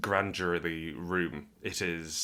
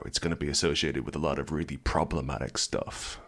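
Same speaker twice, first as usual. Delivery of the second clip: usual speaking voice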